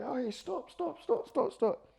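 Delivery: very high, light falsetto calls; onlookers' mock protest. Onlookers telling the fighting couple to stop, in mock falsetto. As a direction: squeal a string of little stops.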